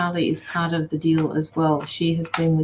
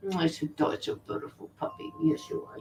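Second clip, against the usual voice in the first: hushed, adoring coo to a dog; soft pet cooing; 10 dB quieter than usual. Real, not acted. Owner praising her beautiful puppy, softly cooing. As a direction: murmur sweet praise, almost whispered.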